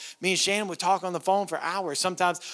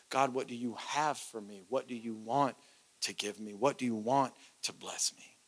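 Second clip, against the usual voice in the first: humble prayer; 10 semitones lower, 12 dB quieter than usual. Pretend.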